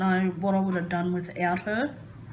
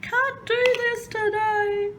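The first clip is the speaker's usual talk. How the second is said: high, slow, drawn-out wail of defeat; despairing whine; slower than usual. Acted. Opening her video, she wails that she can't cope. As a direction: stretch out "can't" like you're giving up. Opening line of anxiety vlog.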